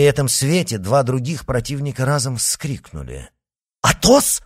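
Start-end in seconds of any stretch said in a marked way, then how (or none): 3.8-4.4 name cried out high and loud; loud, much higher cry of a friend's name; startled exclamation. Acted. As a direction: shock of recognition, shouted. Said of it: "воскликнул Д'Артаньян".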